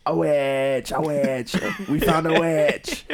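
high, loud, excited mock yelling; mob cry. Crowd comes up to Bedivere, hammed-up accusing shout. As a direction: call it out, building to a drawn-out cry.

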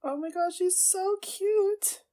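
high-pitched squeal with drawn-out words; gushing. Real, not acted. Fan gushing that she's so cute, high squeal. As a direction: coo over something adorable, slowly.

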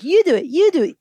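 put-on high, loud little-kid protest; imitating toddler's demand. Mom quoting her son who wants independence, mock toddler insistence. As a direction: insist repeatedly like a determined toddler. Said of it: "he's always like".

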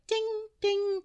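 high sung-out ding like a chime; ping it high like a little bell; imitated ding. Imitating the music box chime for the listener, high bell ding. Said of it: "I keep hearing this".

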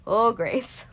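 loud mock alarm at herself. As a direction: shout it in mock panic. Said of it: "and I'm like".

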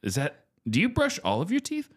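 ordinary delivery

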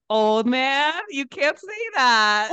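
high, drawn-out playful reprimand; mock scolding. Stripper telling off her elderly regular, mock scandalised. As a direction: scold him in scandalised disbelief.

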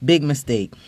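normal talking voice

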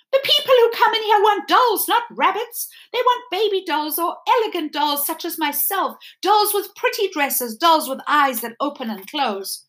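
preen like an elegant doll; vain doll. Acted. The china doll boasting to Edward about herself, vain and high.

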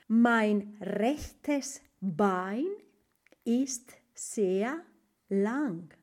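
very slow, clear German; teacher pacing out a German sentence; slow model phrase. Shown as a demonstration. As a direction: space out each word for learners. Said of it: "slow German model phrase".

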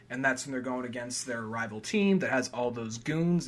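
ordinary talk

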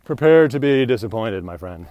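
Princess Bride quote. Retold or imitated. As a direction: quote the film line with flair. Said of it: "Princess Bride".

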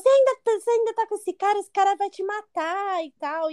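high, rushed mock yell of warning; imitated yelling. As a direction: act out a friend's frantic warning. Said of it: "é um gritando do lado um do outro". (imitando gritaria, agudo e apressado)

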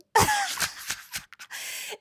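high airy laugh. Real, not acted. Laughing after pidgin imitation.

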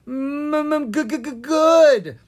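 high, stuttering, slow terrified voice; stammering in fear. Acted. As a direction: stutter each word, scared stiff. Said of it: "Owen stammered".

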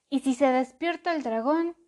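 worried mouse. A narrator's reading. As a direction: worry the dragon wakes. Mouse worried about dragon.

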